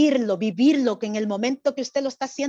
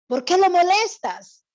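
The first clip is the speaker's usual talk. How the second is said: loud, higher scolding of the beggar; Pharisee scolding; 4 semitones higher, 5 dB louder than usual. Shown as a demonstration. Showing the child playing the Pharisee how to hush the beggar, loud scolding. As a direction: shut him up, sharp and rising. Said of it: "Y va a empezar a decir".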